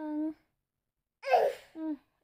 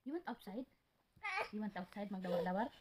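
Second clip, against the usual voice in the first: soft coaxing; 4 semitones lower, 6 dB quieter, faster than usual. Real, not acted. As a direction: coax him softly. Soft coaxing to crying child. (hinay nga pagdani sa bata)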